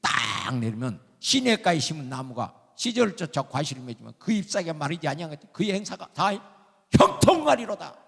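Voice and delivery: loud, high-pitched sermon delivery; preach. Real, not acted. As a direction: proclaim it forcefully to the congregation. Preaches at full force. (힘주어 외치는 설교)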